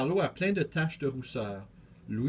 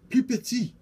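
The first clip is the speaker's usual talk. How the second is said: excited; 11 semitones higher, 6 dB louder than usual. Acted. Tiny tree joke to friend.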